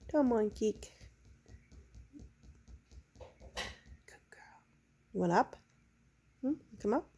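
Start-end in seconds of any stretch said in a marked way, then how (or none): 3.2-4.6 soft whispered praise; whispering. Real, not acted. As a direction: breathe the praise softly.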